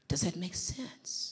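slow, whispered check-in; soft whisper. Real, not acted. Coach quietly checking with a student, whispering. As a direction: lean in and whisper gently.

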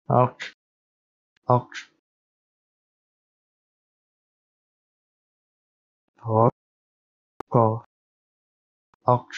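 lock said repeatedly, cola reversed; backwards speech. Shown as a demonstration. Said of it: "now we reverse the sequence".

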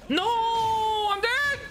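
excited gamer despair; dismayed outcry. Real, not acted. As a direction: cry out high as you lose the round. Loses the round.

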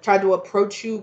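ordinary talk, nothing marked

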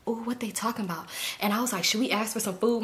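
soft, nosy undertone; acted eavesdropping. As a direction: keep it down as if listening at a door. Retold eavesdropping at a door.

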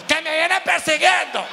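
panicked yell through laughter; frantic shout. Retold or imitated. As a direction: shout it like you're being chased. Él gritando a la señora. (grito desesperado entre risas)

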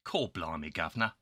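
put-on quick Cockney accent with a soft, dropped voice. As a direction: lay on a stock London Cockney. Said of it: "the myth that all Brits are Cockneys".

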